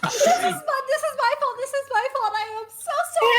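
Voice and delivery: high, loud laughing wail; laughing apology. Real, not acted. Player owns up to the table, high laughing apology.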